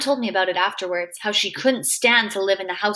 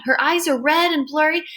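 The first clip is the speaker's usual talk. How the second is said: ordinary delivery, nothing marked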